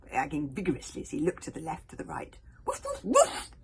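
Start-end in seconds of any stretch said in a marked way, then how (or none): 2.6-3.5 very high, loud playful woofs; silly hogfly barking; happy barks. Acted. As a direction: bark like a happy puppy. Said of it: "barked the happy little hogfly".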